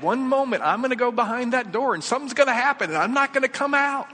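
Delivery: high, loud, worked-up worry; mock dread. Waiting outside the courtroom door, mounting mock dread. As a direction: build the dread, pitch climbing. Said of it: "you get that sense of dread".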